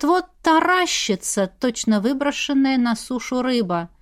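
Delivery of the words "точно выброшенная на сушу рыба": ordinary delivery, nothing marked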